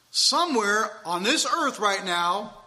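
normal talking voice

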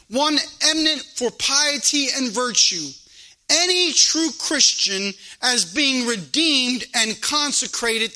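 insistent preacher challenging the flock; preaching. Real, not acted. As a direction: proclaim it to the congregation, leaning on key words. Preaches and reads scripture.

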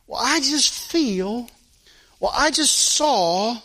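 slow, drawn-out mimicry of a believer; mock feelings-led churchgoer. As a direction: imitate someone dragging out each excuse. Mimics people trusting their feelings.

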